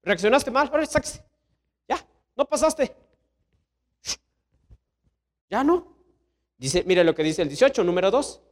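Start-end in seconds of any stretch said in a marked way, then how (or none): 0.0-6.0 higher, dismissive firm voice; God refusing. Acted. God turning Esau away, dismissive and firm. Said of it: "Dice Dios".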